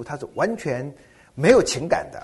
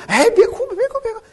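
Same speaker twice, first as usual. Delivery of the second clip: much higher and louder, a comic almost-squeal; mock exasperated hushing; 9 dB louder than usual. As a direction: shoo the crying away with exaggerated, high-pitched urgency. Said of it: "你一定说：".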